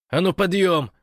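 loud, high wake-up order; rousing command. A narrator's reading. Gosh to the sleepers, loudly ordering them up. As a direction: call the sleepers to their feet.

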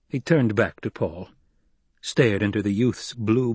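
ordinary delivery, nothing marked

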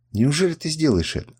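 a touch of alarm, not truly whispered; frightened question. A narrator's reading. Captive woman asking her captor, mildly frightened. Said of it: "спросила она испуганным шёпотом".

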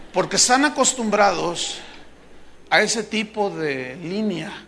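normal talking voice

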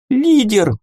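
high sudden blurt. A narrator's reading. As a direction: blurt it out eagerly. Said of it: "выпалила фея".

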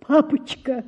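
со страхом. A narrator's reading. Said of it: "терзаясь от страха".

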